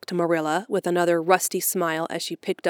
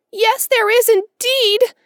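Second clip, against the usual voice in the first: put-on high, loud girlish voice close to tears; an orphan girl pouring out her despair; 6 dB louder, slower than usual. A narrator's reading. Orphan girl to Marilla and Matthew, high despairing wail.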